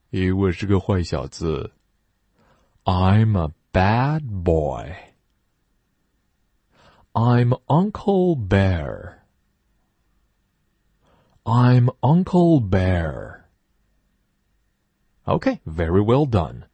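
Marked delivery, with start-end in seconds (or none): 2.8-13.4 slow, drawn-out model sentences; slow teacher model sentences; slow loud reading. Shown as a demonstration. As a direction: read them slowly for the class. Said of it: "一起大声朗读".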